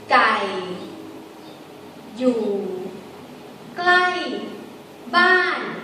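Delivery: low gai, then falling klai and ban; Thai sentence tones. Shown as a demonstration. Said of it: "Gai is low tone".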